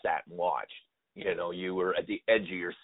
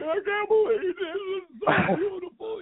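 high, teary, choked-up sob, much higher; overwhelmed sobbing cry, pitched way up; imitating crying. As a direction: act out bawling through the anthem. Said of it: "I start bawling".

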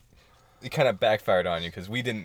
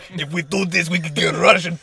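put-on Russian accent; 7 semitones higher, 7 dB louder than usual. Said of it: "in a Russian accent".

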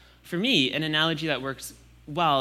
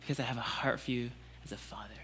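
softer tender plea; God softly pleading with a doubter; soft fatherly plea; 7 dB quieter than usual. Pretend. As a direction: speak gently, letting them choose. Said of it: "God saying".

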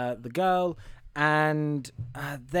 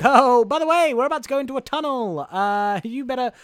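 put-on American accent, urgent and pitched up; Tom Hanks's hobo; 6 semitones higher than usual. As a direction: call out as a hammy Boston-New York drifter. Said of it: "'cause he's like".

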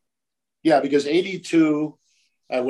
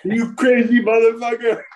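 mock yell; 10 semitones higher, 6 dB louder than usual. Client's admiring retort voiced.

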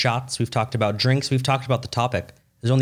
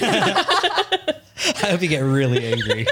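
laughing; 8 semitones higher, much slower than usual. Real, not acted. Teasing Katie about drunk moods.

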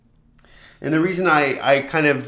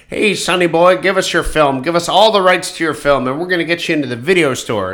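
slick, louder sleazy dealmaker; hammed-up pushy distributor pitching a deal; stupid distributor voice; 3 semitones higher, 3 dB louder than usual. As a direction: filmmaker mocks a distributor making his pitch, in a silly voice. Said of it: "I'm gonna use a really stupid voice".

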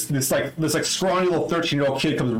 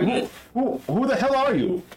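high, stammered question; baffled demand, pitched up; confused; 9 semitones higher than usual. Acted. Kid facing armed strangers, high bewildered question. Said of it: "he's, like, really confused".

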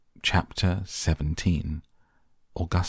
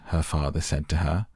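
ordinary delivery

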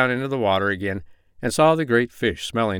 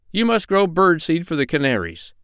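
higher-pitched, lightly acted stern doctor; 7 semitones higher than usual. A narrator's reading. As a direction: lecture a pirate firmly in a raised pitch. Doctor lays down terms.

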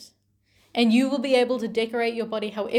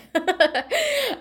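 short laugh falling away; a quick real laugh at a friend; brief amused laugh; 10 semitones higher, 4 dB louder than usual. Real, not acted. Amused aside about Edie's look.

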